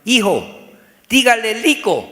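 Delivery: father mispronouncing "rico" with an L; dad's faulty R. Acted. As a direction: instruct the son, saying L for R in "rico". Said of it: "papá no puede pronunciar rico, sino rico".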